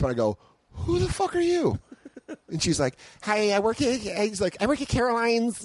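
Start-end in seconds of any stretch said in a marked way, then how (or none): 0.7-1.8 shocked. Retold or imitated. Startled by stranger at door. 3.2-5.6 put-on girly voice. Imitating the blonde girl.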